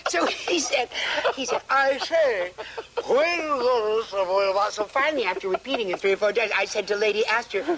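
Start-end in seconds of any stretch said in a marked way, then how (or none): 1.7-4.8 posh English mumbled through the teeth; long tooth accent. Retold or imitated. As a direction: say it like an old aristocrat who barely opens his mouth. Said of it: "Long tooth accent".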